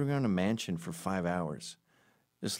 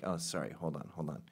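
softer, hurried apology; fumbling fan; 3 semitones lower, 7 dB quieter than usual. Retold or imitated. Fan fumbles with phone for a selfie, soft and apologetic. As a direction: mumble while fumbling the phone. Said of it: "they're like".

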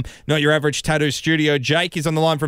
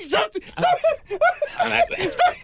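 being tickled. Real, not acted. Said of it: "stop tickling me".

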